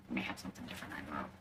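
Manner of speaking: voiceless hushed murmur; whisper. Real, not acted. Vlogger musing about supper, whispered. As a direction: drop to a whisper as an aside.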